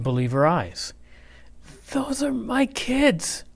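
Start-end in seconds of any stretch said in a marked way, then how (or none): 1.9-3.4 higher, slow teacher voice; a startled teacher, drawn out; Miss Nelson. A narrator's reading. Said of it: "she said in a scratchy voice".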